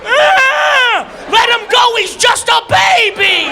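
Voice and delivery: high, loud frantic shout; panicked whale. Pretend. Killer whale screaming to save the baby, high and loud. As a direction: scream like a frightened mother whale.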